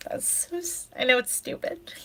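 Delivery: small, high, tearful voice; real crying. Real, not acted. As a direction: choke up and barely get it out. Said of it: "I'm sorry for crying".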